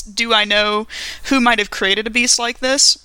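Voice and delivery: ordinary talk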